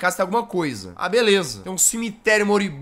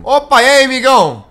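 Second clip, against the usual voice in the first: big loud higher hello to a stranger; loud excited greeting; 5 semitones higher, 10 dB louder than usual. Real, not acted. Streamer greeting the giant egg, loud excited hello.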